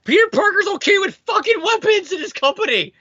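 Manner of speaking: high, exasperated protest; indignant shout. Real, not acted. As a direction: cry out in disbelief. Indignant outburst at comic.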